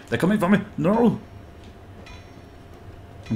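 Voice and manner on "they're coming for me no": high panicked cry. Real, not acted. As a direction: squeal it in rising panic. Enemies approaching.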